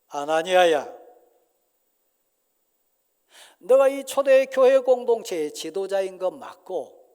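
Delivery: loud, stern apostle's call; Peter rebuking. Acted. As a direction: confront him by name, loud. Peter confronting Ananias. (베드로가 아나니아를 꾸짖듯 크게)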